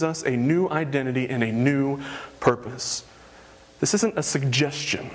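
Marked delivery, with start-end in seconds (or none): none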